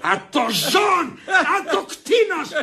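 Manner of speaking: slow, stretched exasperated shouts; drawn-out indignant exclamations; outraged cry. Acted. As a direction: bellow each word out in outrage.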